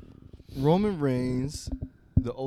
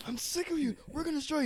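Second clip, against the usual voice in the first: much higher mocking threat; faster than usual. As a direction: squeak out the threat like a cartoon. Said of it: "He looks like he".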